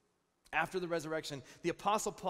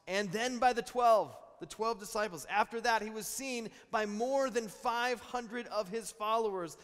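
preaching build; 5 semitones higher, 7 dB louder than usual. Acted. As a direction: drive it home to the congregation. Preaching climax on witnesses.

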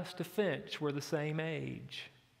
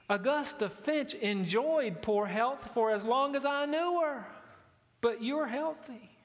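lightly voiced Andy, higher and bright with encouragement; a hint of Andy's Southern comfort, pitched up; Andy consoling; 7 semitones higher than usual. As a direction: cheer her up with a folksy line, pitched higher. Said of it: "Andy ... says".

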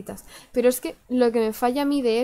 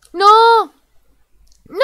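loud high panicked scream; 12 semitones higher, 17 dB louder than usual. Real, not acted. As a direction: shriek it in mock panic. Player screams in mock panic.

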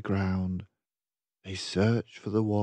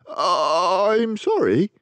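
slow, higher, scared sorry; spluttering apology; 8 semitones higher, 5 dB louder, much slower than usual. Acted. Frightened dragon apologises to the beast, slow and spluttering. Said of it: "he spluttered".

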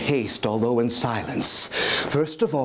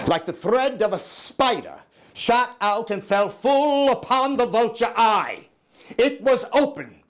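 higher, tense, rising fury; intense madman narrator; 11 semitones higher than usual. Acted. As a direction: spit out each word with disgust. Madman narrator grows furious.

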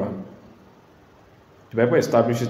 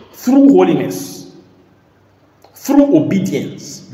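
emphatic preaching; 7 semitones higher, 8 dB louder, much slower than usual. Real, not acted.

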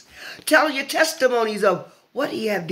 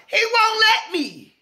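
high, stretched-out insistence; slow, high-pitched preacher's emphasis; emphatic preaching cry; 13 semitones higher, slower than usual. Real, not acted. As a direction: draw out WON'T on a high held pitch. Emphatic climax of preached point.

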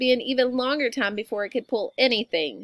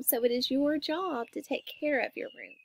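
usual speaking voice

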